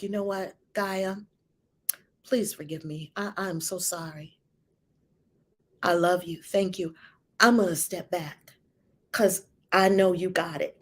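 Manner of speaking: low, soft retold apology; gentle apology. Retells apology to her daughter.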